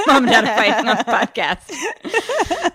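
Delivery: laughing through the words. Real, not acted. Laughing at the bickering.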